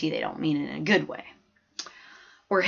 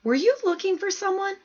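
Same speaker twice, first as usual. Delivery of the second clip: high-pitched question; 12 semitones higher than usual. A narrator's reading. Teacher asks the stranger.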